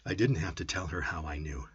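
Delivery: plain reading voice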